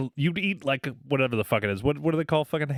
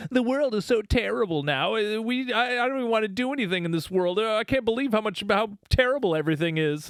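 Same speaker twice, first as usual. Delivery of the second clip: higher, sing-song whining about how terrible the world is; mock whiny complainer; 8 semitones higher than usual. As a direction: parody the self-pitying pessimist, lightly. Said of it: "all these people nowadays who are just like".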